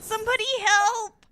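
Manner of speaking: high, loud call for help; cry for help. Acted. Game character who found Phoenix down, crying out for help.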